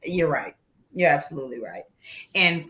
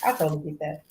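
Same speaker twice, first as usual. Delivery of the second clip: normal talking voice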